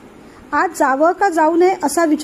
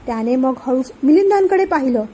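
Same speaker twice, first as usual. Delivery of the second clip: usual speaking voice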